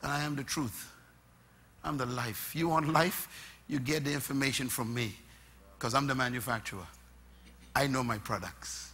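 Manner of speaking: low, soft, assured reply; calm Christ. Retold or imitated. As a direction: answer quietly with full authority. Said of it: "And Christ says".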